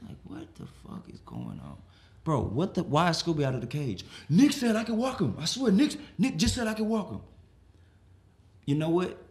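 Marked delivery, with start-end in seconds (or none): 0.1-1.8 low, hushed bewildered muttering; bewildered dog. Acted. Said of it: "Scooby was just like this". 4.3-7.2 high, insistent excuse; defensive protest. Acted. Houseguest's defensive excuse, acted.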